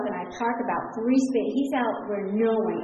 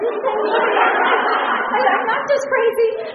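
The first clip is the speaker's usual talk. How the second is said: high, loud laugh through the words; 11 semitones higher, 8 dB louder than usual. Real, not acted. Telling the crowd about the parking lot, laughing. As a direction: laugh it out in disbelief. Laughing at her own story.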